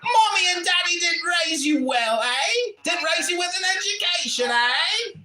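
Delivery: mock-outraged put-on character yelling at the room; put-on comic voice. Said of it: "I guess I can stop talking like this now".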